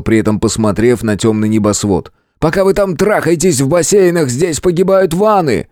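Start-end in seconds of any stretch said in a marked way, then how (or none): 2.4-5.7 high, accusing cry; furious shouting. A narrator's reading. Hero rails at the night sky, shouting. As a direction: rail at the heavens, voice high. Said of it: "вновь сорвался и закричал".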